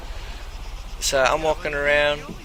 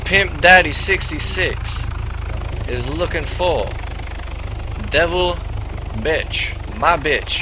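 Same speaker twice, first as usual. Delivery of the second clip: slow and deliberate; slower than usual. Retold or imitated. Pimp slowly spelling out his search for the stranger. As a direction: spell it out like to a child. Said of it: "say it nice and slow for you".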